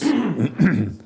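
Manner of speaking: gravelly throat clear with a laugh. Real, not acted. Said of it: "with a terrible voice".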